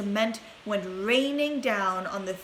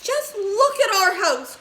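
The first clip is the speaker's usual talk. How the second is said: high loud outraged shout; father crying out over his ruined house; dismayed cry; 11 semitones higher, 8 dB louder than usual. A narrator's reading. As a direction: wail at the wreckage. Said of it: "cried poor Mister Bucket".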